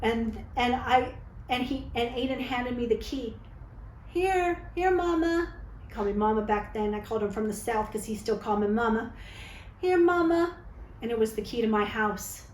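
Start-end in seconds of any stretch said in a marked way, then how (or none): 4.1-5.5 put-on higher, sweet child offering; lightly voiced son; little boy voice. Child voiced handing key. 9.8-10.6 put-on higher, sweet child offering; lightly voiced son; little boy voice.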